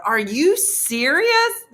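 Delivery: slow, loud, high mock disbelief; exasperated. Two of them standing in the foyer, exasperated. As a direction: draw it out, hammed-up. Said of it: "his exasperation".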